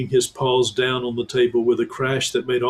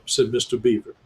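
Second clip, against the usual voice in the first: usual speaking voice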